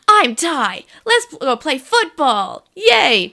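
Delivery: loud, high, hammed-up eager kid; slow cheery mock introduction, punched out loud; Taichi. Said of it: "Taichi's are like".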